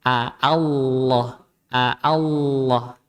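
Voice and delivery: "Allah" slow, open a first and long held l. Shown as a demonstration. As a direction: lead into "Allah" from a clean "A". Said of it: "adanya A".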